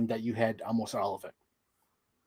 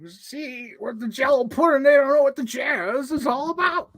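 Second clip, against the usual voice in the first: Cosby impression; 11 semitones higher, 3 dB louder than usual. Cosby impression after Cosby talk.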